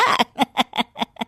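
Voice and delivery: soft, falling scornful laugh; a quiet derisive chuckle; mocking laugh. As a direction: laugh him off as a fool. Mother-in-law laughs at customer.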